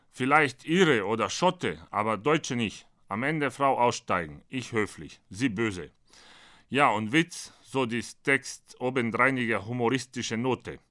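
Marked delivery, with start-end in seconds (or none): none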